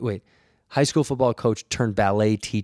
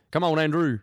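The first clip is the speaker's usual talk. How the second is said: pitched-up impression of an old Southern coach; boisterous Southern man; 5 semitones higher than usual. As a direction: call out like dad's big Southern friend. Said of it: "heavyset guy from the South".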